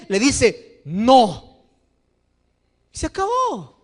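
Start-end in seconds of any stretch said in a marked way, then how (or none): 0.8-1.6 firm no. Retold or imitated. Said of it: "si el Padre es firme".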